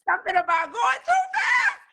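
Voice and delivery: high, loud outraged cry; indignant outburst. Retold or imitated. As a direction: fling the words out in disbelief. Indignant retelling of Miranda's line.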